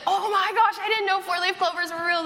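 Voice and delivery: high, loud gushing amazement; imitated excitement. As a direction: gush like thrilled strangers. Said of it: "They were so excited".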